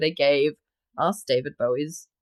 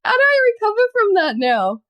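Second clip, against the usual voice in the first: high, loud squeal through laughter; helpless laughter; 7 dB louder than usual. Real, not acted. Reader after botching the American voice, high laughing squeal. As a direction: squeal it while cracking up. Cracked up at failed accent.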